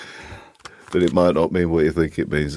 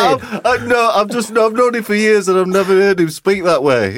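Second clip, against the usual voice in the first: being tickled; 12 semitones higher than usual. Real, not acted. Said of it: "I don't know why it tickled me".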